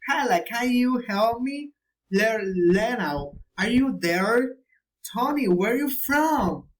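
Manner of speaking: "can you help me" high, with an exaggerated lilt; direct question intonation. Shown as a demonstration. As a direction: swoop up and down on "where are you from". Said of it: "the right question is intonation".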